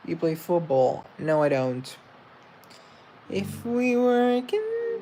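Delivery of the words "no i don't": usual speaking voice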